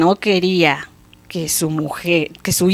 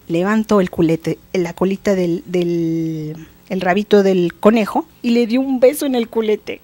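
plain reading voice

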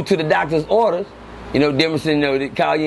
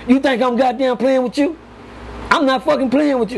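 snapping lieutenant; 7 semitones higher than usual. Retold or imitated. Lieutenant snapping at a cocky inmate, mock angry. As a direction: imitate the lieutenant snapping, a mild mock yell.

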